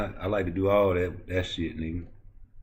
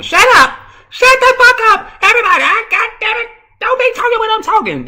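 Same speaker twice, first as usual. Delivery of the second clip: shrill shrieking scream, much higher and louder; screaming mama; 13 dB louder than usual. Retold or imitated. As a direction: scream like an angry mother silencing the room. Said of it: "just like your mama".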